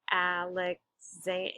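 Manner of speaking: "Alexan-" very slowly, A-lex-zan; broken into syllables. Shown as a demonstration. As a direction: sound out "Alexan-" piece by piece with gaps. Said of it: "it's Zan that's actually, um, stressed".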